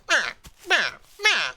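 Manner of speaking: short, high hatchling bleats; imitated baby alligator. Baby alligator out of its egg, imitated bleating. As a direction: squeak like a newly hatched gator. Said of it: "just go like".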